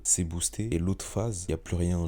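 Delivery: ordinary talk, nothing marked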